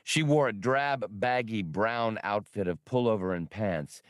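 plain reading voice

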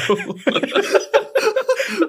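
shrill wheezing giggle; high squealing laugh. Real, not acted. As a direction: burst out in a high cackle. Laughing at "ukai.

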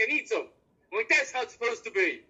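normal talking voice

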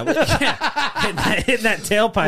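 high, laughing through the words; helpless giggly delivery. Real, not acted. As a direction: crack up while saying it. Cracking up over own dirty joke.

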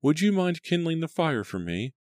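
high gentle mother voice; mother's voice. A narrator's reading.

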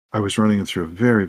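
ordinary talk

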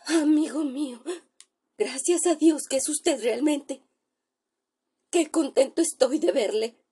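high, shaken voice of a terrified doctor; a doctor sure he sees a ghost, voice rising in fear; frightened doctor. Acted. Terrified doctor tells his friend he looks like a corpse, high shaken voice.

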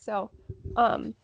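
ordinary delivery

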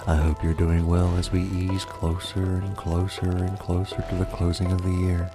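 spooky ASMR podcast host; horror host. Acted. ASMR murder podcast host intro.

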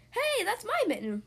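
delighted discovery. Acted. Amelia spots her old mitten.

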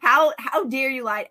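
hammed-up indignation; mock outrage. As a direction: imitate an affronted accuser. Acts out people accusing her.

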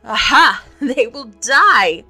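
loud, gleeful villain's laugh; bursting into laughter. A narrator's reading. Hook gloats over the doomed boys, bursting into laughter. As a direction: burst out loud, drawing out the last words. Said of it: "He burst into laughter, not hollow laughter now, but honest laughter".

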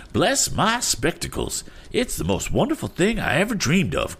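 high-pitched, marveling eccentric; queer character's cry. A narrator's reading. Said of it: "cried the queer character".

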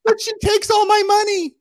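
very high squeaky put-on voice; comic high-pitched complaint; mock whining lament. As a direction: squeal it in falsetto for laughs. Joking as the abused partner.

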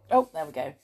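plain reading voice

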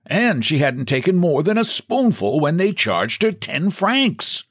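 put-on high, piping old man, mildly outraged; indignant old clerk. Old clerk complaining about hotel prices, indignant. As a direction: grumble about being overcharged.

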